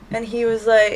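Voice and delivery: normal talking voice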